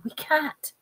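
high, soft frightened protest. A narrator's reading. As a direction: say it small and high, scared.

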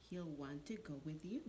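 usual speaking voice

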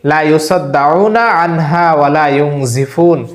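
slow, measured Arabic recitation; Quranic recitation. Shown as a demonstration. Imam teaching viewers a verse, slow recitation. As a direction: chant the verse slowly for learners to follow. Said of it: "আবারও বলছি".

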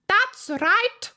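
high, loud, pleased shout; shouting queen. A narrator's reading. Queen shouting approval to the soldiers. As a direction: bellow approval. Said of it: "shouted the Queen".

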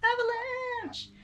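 high, drawn-out "Avalanche!"; mock alarm call. [name] calls out a pretend avalanche to partner, mock alarm. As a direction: call it out high and long, playfully.

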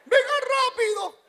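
high fussy mother-in-law call. Retold or imitated. Son-in-law imitating his mother-in-law calling her daughter home. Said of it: "decía mi suegra".

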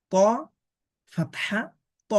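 normal talking voice

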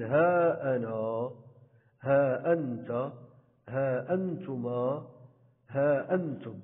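ها held long before أنا، أنت، أنتما، أنتم; alif sounded long. Shown as a demonstration. Teacher listing pronoun examples, each ها stretched into a long haa. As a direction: draw out "haa" in each ها, slowly. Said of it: "قمنا بنطق الألف".

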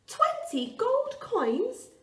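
high, slow, delighted disbelief; amazed cry. Acted. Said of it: "cried Jack".

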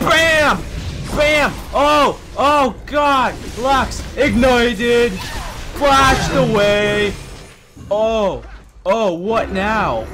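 very high, loud, stretched-out exclamations; exultant battle shouting. Real, not acted. Player killing Lux, shouting in wild triumph. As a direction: yell each hit as it lands. Yelling through a kill.

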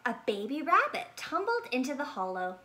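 usual speaking voice

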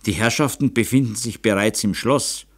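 ordinary delivery

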